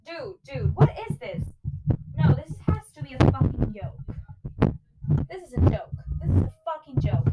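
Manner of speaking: fed up, pitch climbing in disbelief; exasperated complaint. Real, not acted. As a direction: protest at a maddening task. Struggling to balance the microphone.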